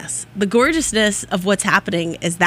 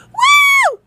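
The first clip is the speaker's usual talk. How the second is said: whoop; 6 dB louder than usual. Real, not acted. Cheers after laughing.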